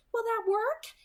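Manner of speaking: high, loud strained question. A narrator's reading. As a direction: ask tensely, afraid of the answer. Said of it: "Alice's voice was strained".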